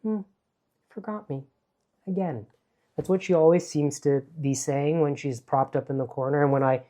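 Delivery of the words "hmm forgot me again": lightly voiced sulky umbrella, a touch higher; a mild hint of a hurt, forgotten friend; umbrella's reproach. The umbrella chiding her owner, lightly sulky voice. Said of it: "she seemed to say".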